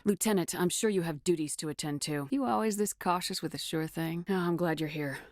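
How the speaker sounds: normal talking voice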